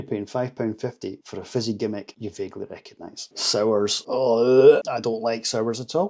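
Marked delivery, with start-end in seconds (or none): 4.1-4.8 loud, drawn-out "ugh" of distaste; disgusted groan. Real, not acted. Reviewer reacting to sours he doesn't like, disgusted groan. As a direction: groan in open dislike.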